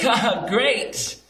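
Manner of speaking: high, slow, forced haha; awkwardly cheery, drawn-out reply; awkward fake laugh. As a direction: fake a polite laugh and stretch it out. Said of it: "should I just say, like".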